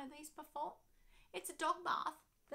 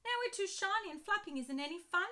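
moaning; 8 semitones higher, 4 dB louder than usual. Acted. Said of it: "moaned Ben".

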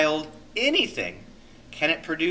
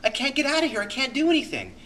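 a person cornered at work or home, complaining helplessly in a much higher voice; 11 semitones higher, faster than usual. Retold or imitated. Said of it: "where we go".

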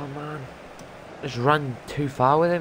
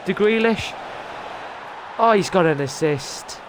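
excited; 7 semitones higher than usual. Real, not acted. Reacting to a goal.

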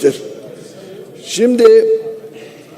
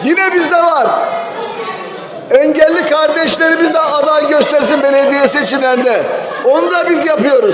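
angry shouting; 6 semitones higher than usual. Real, not acted. Heated protest at the podium.

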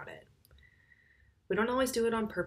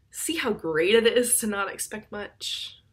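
loud, drawn-out mock enthusiasm; overdone cheery sarcasm; sarcastic; 2 semitones higher, 6 dB louder than usual. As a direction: lay the sarcasm on slow and loud. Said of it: "I'm being sarcastic".

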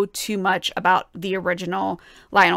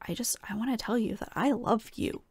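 soft whisper of the singer's confession; whispered quote; 10 dB quieter than usual. Retold or imitated. To viewers, echoing the singer's words in a whisper. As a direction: whisper it like a secret longing.

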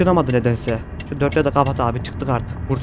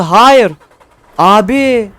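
frustrated shout; 4 semitones higher, 7 dB louder, much slower than usual. Real, not acted. Truck breaks down mid-climb.

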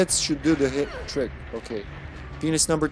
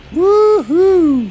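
very loud, high falsetto whoop; piercing, drawn-out celebratory holler; excited whoop; 12 dB louder than usual. Real, not acted. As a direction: let out a big wild cheer. Whooping during the fight.

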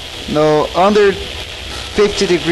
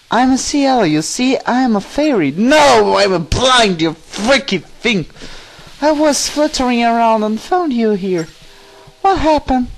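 lightly voiced fairy, often higher; chirpy fairy; 6 semitones higher than usual. Fairy greeting Link she found, lightly put-on high voice. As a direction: play a little fairy with a touch of high voice.

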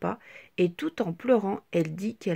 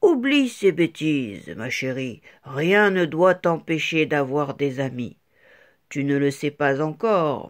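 lower, louder, steady father; 7 semitones lower, 4 dB louder than usual. A narrator's reading. Father comforts his crying daughter, lower voice. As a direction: comfort her like a big papa. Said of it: "son papa lui dit".

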